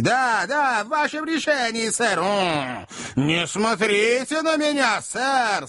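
high, insistent jealous tirade; acted quarrel at full tilt; angry outburst. A narrator's reading. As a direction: snap back in high-pitched fury.

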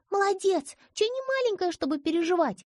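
high little girl mimicking an elder; child copying grandmother. A narrator's reading. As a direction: parrot grandma's scolding tone. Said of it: "Копируя её интонации". (девочка передразнивает бабушку)

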